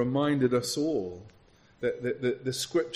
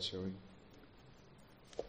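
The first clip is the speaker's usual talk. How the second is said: ordinary talk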